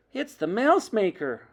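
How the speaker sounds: put-on high, excited child's exclamation; excited cry. A narrator's reading. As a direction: cry out the discovery. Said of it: "I cried".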